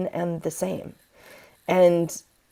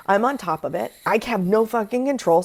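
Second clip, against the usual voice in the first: ordinary talk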